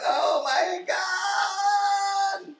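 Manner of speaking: high, drawn-out imitation of rapture; mock ecstatic cry. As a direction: stretch it out in a hammed-up high voice. Imitates a character's ecstatic reaction.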